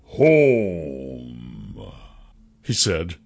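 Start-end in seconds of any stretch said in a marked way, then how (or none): 0.0-1.9 low, thick creature voice; thickened voice. A narrator's reading. Cursed king answers where he goes, thick low voice. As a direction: let the word sink thickly downward. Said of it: "His voice thickened".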